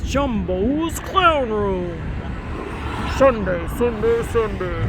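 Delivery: stretched-out event-promo voice; hammed-up advert announcer; mock announcer. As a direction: hype it like a cheesy Sunday event ad.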